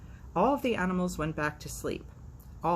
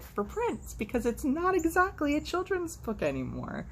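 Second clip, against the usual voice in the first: sassy aside; 12 semitones higher than usual. Real, not acted. Sarcastic commentary.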